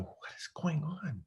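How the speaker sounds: unvoiced anxious whisper; whispered to himself. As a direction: whisper it under your breath. Inner panic in showroom.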